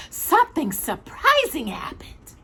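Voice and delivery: whispered hush for a surprise; suspense whisper. A narrator's reading. Storyteller to listeners, whispered suspense. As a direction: lean in and breathe it.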